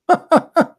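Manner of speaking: short scornful burst of laughter; mocking laugh. Real, not acted. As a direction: laugh at him, short and high. Mocking the caller's argument.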